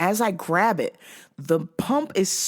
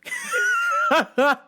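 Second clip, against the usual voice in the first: high cackling laugh. Real, not acted. Storyteller cracking up at the pumping tale, high cackle. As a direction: burst of high-pitched cackling.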